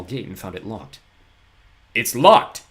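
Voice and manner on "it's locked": louder alarmed cry; cried. A narrator's reading. Poe tells children the gate is locked, crying out. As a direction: call out in alarm. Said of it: "he cried to the children".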